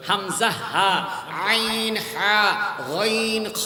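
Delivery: "হামজা, হা, আইন, হা, গইন, খ" drawn out from the throat; throat letters demonstrated. Shown as a demonstration. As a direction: sound each of "হামজা, হা, আইন, হা, গইন, খ" slowly in Arabic throat articulation. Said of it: "গলার ভিতর থেকে ছয়টা হরফ উচ্চারণ হয়".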